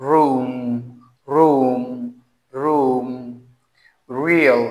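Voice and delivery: each word slowly with a clear initial r; teacher drilling r. Shown as a demonstration. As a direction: model every word for the class to repeat. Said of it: "আমরা বলতে পারি এটাকে". (শিক্ষকের মতো স্পষ্ট র দিয়ে শব্দ পড়া)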